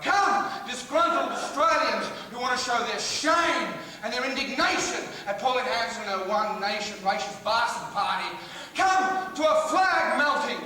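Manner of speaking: drawn-out proclamation to a crowd; mock event crier. Comic summoning a crowd to his event, mock proclamation. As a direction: call them in slowly, like a showman.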